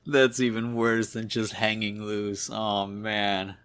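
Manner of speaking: usual speaking voice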